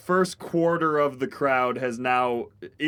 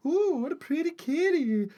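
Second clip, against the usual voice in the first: cooing at kitty; 12 semitones higher than usual. Acted. Crowd petting cats.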